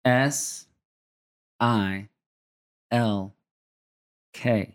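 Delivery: very slow, letters far apart; low, deliberate letter-by-letter spelling. Shown as a demonstration. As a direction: spell it out slowly, one letter at a time. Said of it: "to slowly, very slowly, spell".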